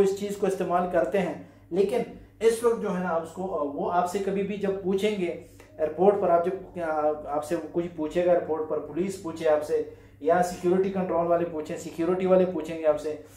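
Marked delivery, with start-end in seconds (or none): none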